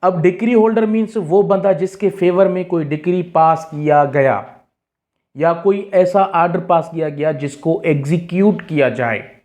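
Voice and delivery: usual speaking voice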